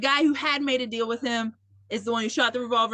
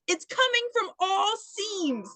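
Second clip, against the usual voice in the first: ordinary delivery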